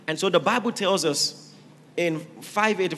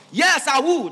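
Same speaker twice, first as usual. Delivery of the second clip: soldiers' shout; 6 semitones higher, 5 dB louder than usual. Acted. Soldiers answer the commander.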